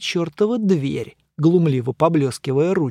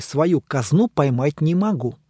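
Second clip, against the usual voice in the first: ordinary delivery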